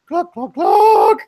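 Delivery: high, loud, slow chicken clucks; slow imitated clucking. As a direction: draw out each cluck like calling the bird. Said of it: "Pip runs over there".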